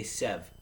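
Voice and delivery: sev, clipped short, the last syllable dropped. Shown as a demonstration. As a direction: count seven as a clipped sev. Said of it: "fun little helpful tip is you say sev".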